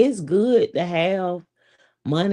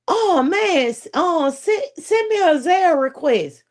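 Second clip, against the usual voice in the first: high, louder mock husband voice; imitating her man; 10 semitones higher, 4 dB louder than usual. Man insisting she send a Zelle request, high mock. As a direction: play him up high and eager. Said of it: "he was like".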